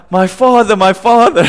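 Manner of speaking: a hammed-up amazed cry; excited cry. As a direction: Elisha shouting after the chariot. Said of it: "Elisha is like".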